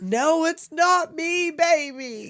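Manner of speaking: high, loud, slow mock wail; hammed-up tearful cry to a child; distraught mother. Mother answers her lost child, hammed-up wailing.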